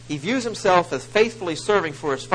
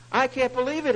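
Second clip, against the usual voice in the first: indignant disbelief; 10 semitones higher, 3 dB louder than usual. Acted. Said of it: "he says".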